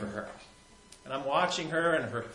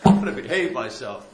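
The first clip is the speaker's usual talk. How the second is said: louder, laughing it out; 3 semitones higher, 11 dB louder than usual. Shown as a demonstration. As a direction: answer your own question with a laugh.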